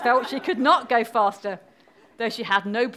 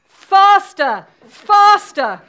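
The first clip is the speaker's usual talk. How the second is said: high, very loud urging shouts; crying out; 9 semitones higher, 13 dB louder, much slower than usual. Acted. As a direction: shout at full force like a queen commanding. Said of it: "cried the Queen".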